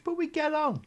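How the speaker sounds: very high, loud put-on squeak; high-pitched, hammed-up insistence; squeaky mock reassurance. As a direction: jump up into a comic squeal. Jokey put-on cheery reassurance.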